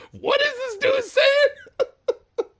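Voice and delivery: very high, slow, squealed laughing question; retold laughing bafflement. Host recalls not understanding Jackie Chan, laughing bafflement. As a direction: squeal it slowly through helpless laughter.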